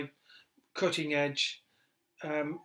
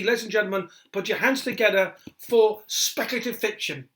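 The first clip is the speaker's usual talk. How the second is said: announcer intro; 5 semitones higher, 10 dB louder than usual. Acted. Radio host introducing the next act to listeners, booming announcer style. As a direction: build it up like a compère bringing on the act.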